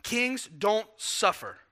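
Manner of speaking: slow and flat-out certain; Peter's blunt thought. Retold or imitated. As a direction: lay each word down like a fact. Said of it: "Peter in his mind goes".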